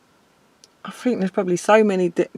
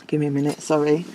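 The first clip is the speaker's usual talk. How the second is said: low, soft request to pause; quietly collecting yourself, really moved; emotional; 5 semitones lower, 7 dB quieter, faster than usual. Real, not acted. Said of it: "It's made me fucking emotional".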